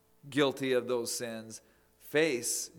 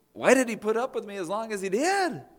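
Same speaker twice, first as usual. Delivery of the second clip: higher, louder outcry stressing "did"; "did" hit hard and falling; preaching outcry; 9 semitones higher, 9 dB louder than usual. Real, not acted. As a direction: cry out the question to the congregation.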